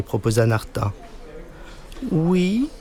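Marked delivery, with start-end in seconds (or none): 2.1-2.7 high, drawn-out mock English accent in French; bad English accent. A narrator's reading. Boy posing as English to a classmate, bad English accent. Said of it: "avec l'accent anglais d'une vache espagnole".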